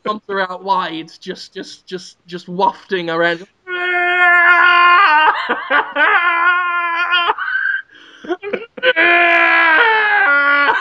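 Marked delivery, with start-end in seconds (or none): 3.7-10.8 high, loud roaring shout; primal roar. Pretend. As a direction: bellow it out wildly. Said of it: "breathe out and go".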